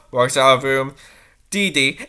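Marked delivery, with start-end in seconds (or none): none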